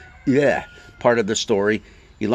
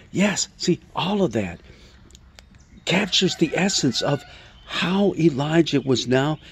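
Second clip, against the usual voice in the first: usual speaking voice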